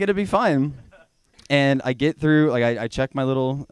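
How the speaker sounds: normal talking voice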